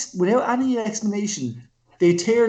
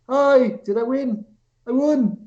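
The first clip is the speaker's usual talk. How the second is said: chirpy mock innocent; 6 semitones higher, slower than usual. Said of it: "And then she's like".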